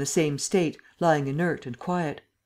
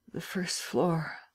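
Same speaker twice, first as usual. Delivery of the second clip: slow, soft, groggy mutter; 2 semitones higher, 6 dB quieter, much slower than usual. A narrator's reading. Drugged Lupin directs the men carrying him, muttering. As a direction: murmur it weakly, barely awake. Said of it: "he muttered".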